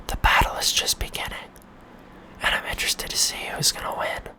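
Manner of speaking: an ominous, breathy stage whisper; hushed, theatrical narrator whisper; dramatic whisper. Pretend. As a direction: whisper it like a trailer voice. Dramatic meme war announcement.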